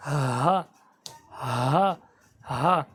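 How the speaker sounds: ہا low with throat vibration; guttural ہا demo; throat sound. Shown as a demonstration. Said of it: "کوشش کریں گے تو ہو جائے گا ادا".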